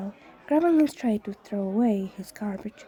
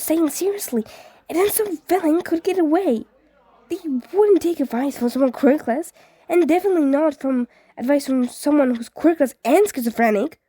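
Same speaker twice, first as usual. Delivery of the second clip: rising panic, higher pitched; anxious boy ranting faster and higher; growing frantic; 5 semitones higher, 3 dB louder than usual. Acted. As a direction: spiral into a frantic rant. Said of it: "voice grew louder and more frantic".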